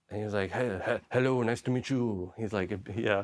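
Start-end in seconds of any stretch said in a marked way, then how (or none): 0.5-2.3 imitated French accent, lightly done. French cameraman greeting the team, French accent. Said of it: "he's French".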